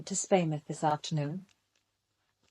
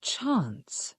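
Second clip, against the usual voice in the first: whispered; 7 dB quieter than usual. Acted. Incredulous whispered echo.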